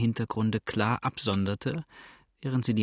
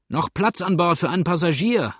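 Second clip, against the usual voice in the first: high, raised hailing voice; calling out; 6 semitones higher, 4 dB louder than usual. A narrator's reading. Man ashore asking for passage, high call. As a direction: repeat the question so it carries. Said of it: "wiederholte der Erste".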